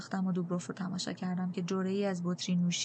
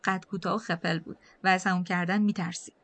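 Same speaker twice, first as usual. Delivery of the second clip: ordinary delivery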